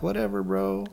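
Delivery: lazy stoned koala. Retold or imitated.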